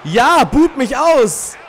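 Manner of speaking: slow, loud challenge to the crowd; loud slow taunt. Real, not acted. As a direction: stretch it out and shout it. Dares crowd to boo him.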